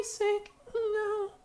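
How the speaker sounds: very high pleading character voice; frightened character cry. Acted. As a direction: squeak it like a scared companion.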